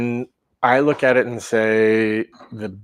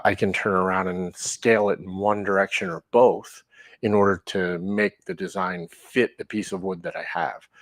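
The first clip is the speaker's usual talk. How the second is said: normal talking voice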